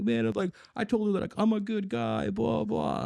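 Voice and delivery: high-pitched imitated whine; mock crying. Crying neighbor pleads about his ex, mock-tearful high voice. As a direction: mimic a tearful, self-pitying plea. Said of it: "he starts crying".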